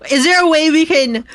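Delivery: ordinary talk, nothing marked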